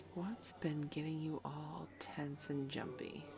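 hushed teasing whisper. Acted. Probing her friend's secret in a sly whisper. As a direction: lean in and whisper it slyly. Sly aside while guessing.

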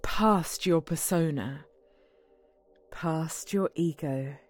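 slow, low hypnotist voice; hypnotic voice. Acted. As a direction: lull him into a trance, gently. Hypnotizing partner with phone app.

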